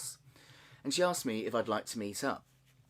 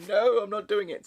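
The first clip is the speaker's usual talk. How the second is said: mock refusal; 10 semitones higher, 9 dB louder than usual. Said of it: "went from".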